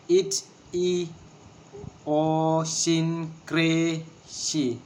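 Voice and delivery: "Idiosyncrasy" very slowly in separate syllables; long even syllables with gaps between. Shown as a demonstration. Teacher showing a word of six syllables, syllable by syllable. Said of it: "six syllables".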